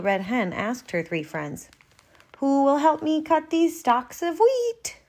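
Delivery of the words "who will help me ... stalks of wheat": little red hen voice. Acted. Hen asks her friends.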